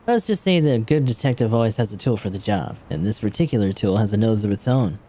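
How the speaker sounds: ordinary delivery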